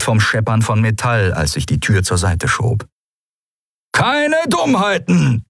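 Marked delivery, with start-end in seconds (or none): 3.9-5.4 high, slow screeched warning; threatening. A narrator's reading. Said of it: "wiederholte Halbhorn".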